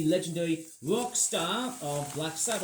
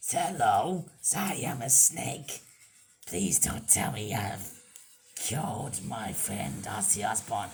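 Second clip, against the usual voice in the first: much lower, slow put-on snake voice; 9 semitones lower, slower than usual. Reviewer gives the toy snake a low, slow creature voice.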